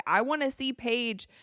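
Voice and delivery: half-shouted, emphatic fan plea; retold scream. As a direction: imitate screaming from the stands, drawn out. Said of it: "what I was screaming last season".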